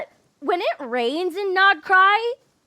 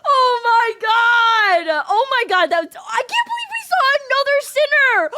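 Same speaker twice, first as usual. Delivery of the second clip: fangirl screaming; 5 semitones higher than usual. Real, not acted. Fan seeing a sinner in the quest, shrieking with delight. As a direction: scream out pure fan joy. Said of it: "thirty more seconds to fangirl".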